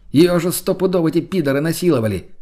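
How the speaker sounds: high-pitched, agitated protest; indignant outburst. Acted. Friend protesting to the couple about the captive, high and outraged. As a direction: exclaim it urgently, scandalized.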